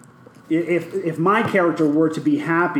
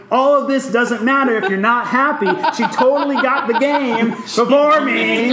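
higher, louder, half-shouted through laughter; laughing mock outrage; 9 semitones higher, 6 dB louder than usual. To friends, retelling realizing Hannah won, laughing mock rant. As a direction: rant at yourself, amused. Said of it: "I was like".

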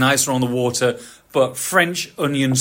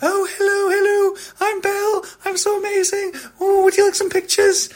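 very high, sugary princess voice; Belle voice. Retold or imitated. Belle greeting a hotel diner, very high and sugary. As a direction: squeal it like a gushing Disney princess.

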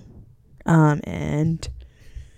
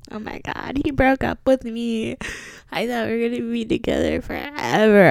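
higher, whiny mock heartbreak; mock heartbroken; 6 semitones higher than usual. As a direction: play the dramatic dumped girl.

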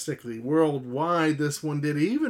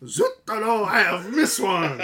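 put-on French accent; 5 semitones higher, 4 dB louder than usual. Joining the French bit.

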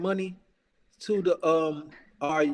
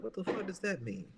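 muttered disbelief; 12 dB quieter than usual. Retold or imitated. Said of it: "and I was like".

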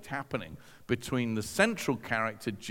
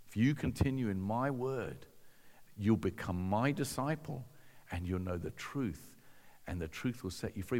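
low, soft, reflective recital; musing over the verse, hushed; quiet pondering repeat; 5 semitones lower, 7 dB quieter than usual. Retold or imitated. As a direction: lower your voice and let it quicken at the end. Repeats the quote to himself, pondering.

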